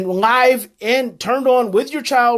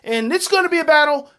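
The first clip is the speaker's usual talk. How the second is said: higher, fast, imitating a frustrated parent ordering; 8 semitones higher, faster than usual. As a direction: rattle off the order like a fed-up parent. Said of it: "frustrated and mad and I say".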